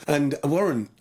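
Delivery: usual speaking voice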